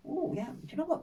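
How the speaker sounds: gasp, then high excited tone; sudden revelation. Retold or imitated. Stranger realising something about Mister Kavanagh, gasping high surprise. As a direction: catch your breath at the discovery.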